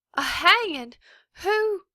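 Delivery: teacher horrified at the sheriff's news; shocked. A narrator's reading. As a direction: gasp out the questions. Shocked question.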